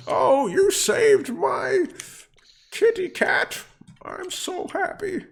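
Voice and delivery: very high, slow falsetto lady; ecstatic woman. Acted. Cat's owner to her rescuer, ecstatic. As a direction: gush in a high-pitched lady's voice. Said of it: "she seems absolutely ecstatic".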